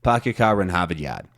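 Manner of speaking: Boston accent dropping every r. Shown as a demonstration. Showing listeners the well-known phrase, Boston accent. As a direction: demonstrate Boston's broad "ah" in place of R. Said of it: "they say ah".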